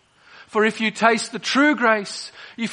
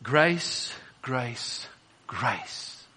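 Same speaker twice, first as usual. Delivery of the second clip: fading to a low, soft murmur; hushed awe, dying away; hushed reverence; 8 semitones lower, 9 dB quieter, much slower than usual. Real, not acted. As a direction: repeat it slowly, each softer and lower. Preacher savours the word grace.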